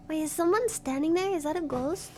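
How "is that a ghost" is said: ordinary delivery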